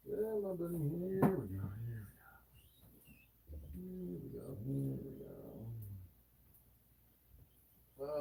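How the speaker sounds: hushed and gentle, talking to the snake; soft coaxing. Real, not acted. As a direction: coax him out softly, almost under your breath.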